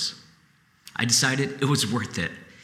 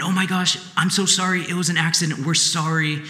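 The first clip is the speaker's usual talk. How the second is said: flustered sorry, words tumbling out; panicked passenger apologizing; 5 semitones higher than usual. Retold or imitated. Passenger rushing out of the car after the crash, panicked apology. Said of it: "starts going".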